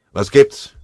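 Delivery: laut und scharf hochgefahren; gereizt. A narrator's reading. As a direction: genervt anblaffen. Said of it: "fragte er gereizt". (gereiztes, lautes Anfahren des Melders)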